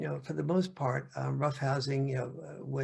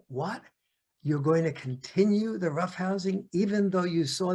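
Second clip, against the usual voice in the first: higher, louder mock outrage; indignant mom; 3 semitones higher, 3 dB louder than usual. Mom scolds dad for continuing roughhousing, raised mock indignation. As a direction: scold the dad as if he's childish. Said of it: "mom is going".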